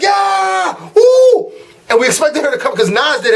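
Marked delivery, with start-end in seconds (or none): 0.0-1.5 high, whooping crowd cheer; imitated concert whoop; excited cheer. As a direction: cheer like a fan when the song drops. Crowd cheering as song starts.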